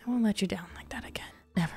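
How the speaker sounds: quick whispered promise; a hushed, hurried pledge to viewers; fast whisper. Real, not acted. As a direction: whisper it fast like a secret vow.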